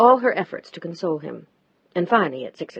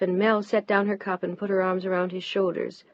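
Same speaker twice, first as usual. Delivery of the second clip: usual speaking voice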